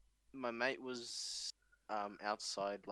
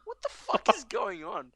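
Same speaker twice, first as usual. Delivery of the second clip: high, loud, laughing what-the-hell; laughing disbelief; 11 semitones higher, 9 dB louder than usual. Retold or imitated. Retelling his shock at the cop's joke, high laughing disbelief. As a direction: squeal it out in stunned amusement.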